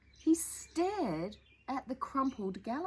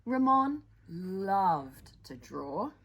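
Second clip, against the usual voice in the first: slow storytelling; 4 dB louder, much slower than usual. A narrator's reading. Opening line of the story.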